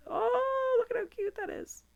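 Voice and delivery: high-pitched squeal over the cute card; squealed delight at a finished card. Real, not acted. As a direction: gush like it's the cutest thing ever.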